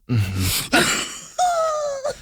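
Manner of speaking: high squeaky fake-okay hum; mock tearful mm-hmm. Asked if she is okay, mock tearful high hum. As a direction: squeak it out as if holding back tears. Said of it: "I was not".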